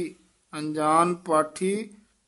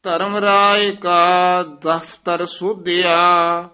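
slow, raised reading of the verse; Gurbani recitation; 2 semitones higher, 7 dB louder, much slower than usual. Acted. Teacher reciting the shabad for the student. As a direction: chant the hymn out solemnly.

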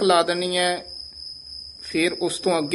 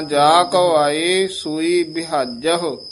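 slow careful recitation; slower than usual. Shown as a demonstration. Teacher shows ਵਿਹਾਜਹੁ said the right way. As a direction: draw the words out. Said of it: "ਵਿਹਾਜੋ ਨਹੀਂ ਬੋਲਣਾ".

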